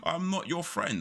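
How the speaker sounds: high-pitched mock protest; retold indignant retort. Retelling his rebuff of a coworker, high indignant protest. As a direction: repeat the rebuff, amused and pitched well up.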